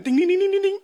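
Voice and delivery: high sing-song ding ding ding; mock bell ding. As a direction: ring it out like a quiz bell. Imitated bell ringing for Petrus. (hohes, gesungenes Klingeln)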